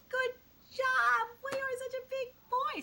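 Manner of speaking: put-on very high-pitched praise to a toddler; cheering mommy. Mommy to her fallen little boy, high cheering praise. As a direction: gush encouragement in a high sing-song.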